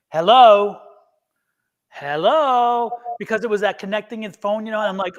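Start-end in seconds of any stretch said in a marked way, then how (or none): 0.1-0.8 loud acted phone greeting; calling down phone. Her answering a call still connecting, calling out louder. 1.9-2.9 higher, drawn-out acted greeting; questioning phone call. Her waiting on a connecting call, drawn-out questioning hello.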